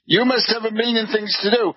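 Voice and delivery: fast, tossed-off repeat of the brush-off; quick mocking repeat. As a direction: rattle it off with a smirk. Mocking repeat after laugh.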